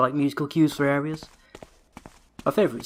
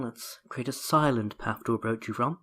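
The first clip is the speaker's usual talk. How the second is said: normal talking voice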